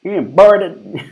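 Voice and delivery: high, loud, drawn-out imitation; mock insult. Streamer quoting woman from the clip, mock outrage. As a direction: hurl it slowly in her put-on voice.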